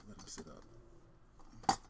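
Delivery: slow unvoiced whisper; hushed. Real, not acted. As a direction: whisper it slowly while setting him up. Quiet aside while arranging figure.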